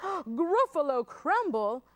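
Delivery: gasp, then loud, slow disbelief; horrified Gruffalo. Acted. Gruffalo hears mouse will eat him, horrified. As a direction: repeat the dish in mock horror. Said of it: "the Gruffalo said".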